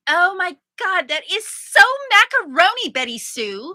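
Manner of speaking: high, loud hammed-up gushing; fifties teen. Using the slang to Betty Sue, gushing fifties teen. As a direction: gush like a put-on fifties teen. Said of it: "That sounds like a fifties thing".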